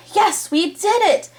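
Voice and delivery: high, bright cry of victory; cheering. Acted. Arnold cheering as the lights flicker on. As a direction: cheer out the win. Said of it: "Arnold cheered".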